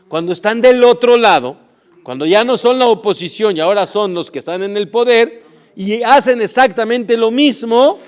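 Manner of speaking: loud, rising preacher's fervor; preaching at full force. Acted. Rabbi preaching to his listeners with loud fervor. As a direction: hammer the point home to the congregation.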